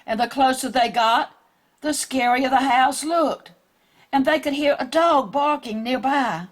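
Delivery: ordinary delivery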